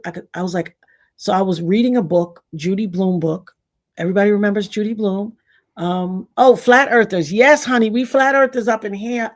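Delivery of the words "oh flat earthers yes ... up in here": louder, higher hype greeting; excited shout-out. Real, not acted. Greeting flat earther fans in the audience, loud and excited. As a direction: cheer the flat earthers in the crowd.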